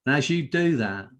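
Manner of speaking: low, measured voice settling at each phrase; guided hypnosis. Real, not acted. Hypnotist relaxing listeners' eyes and body, low calming trance voice.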